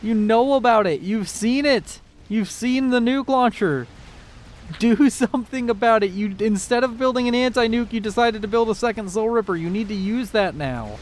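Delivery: high-pitched, agitated pleading; exasperated urging. Real, not acted. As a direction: urge a player who isn't listening to act at once. Caster urges player to attack.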